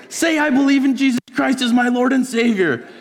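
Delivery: high-pitched, loud shouted order; hammed-up high yell of a little bully; bullying demand. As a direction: shout it like a kid forcing a confession. Child bully repeats forced conversion demand.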